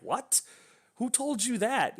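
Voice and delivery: high-pitched, incredulous question; mock disbelief. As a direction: act out the old man's astonishment, voice shooting up. Retold old man reacts in disbelief.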